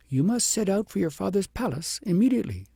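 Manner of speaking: sending her home now; commanding lady. A narrator's reading. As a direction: wise lady ordering princess home. Lady's voice.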